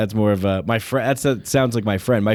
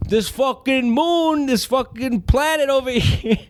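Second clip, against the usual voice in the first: mock tough guy; 12 semitones higher, slower than usual. Mock alien Italian bit.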